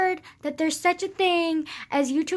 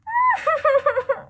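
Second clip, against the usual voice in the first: laughing wail; 11 semitones higher, 5 dB louder than usual. Acted. Laughing wail after mock whine.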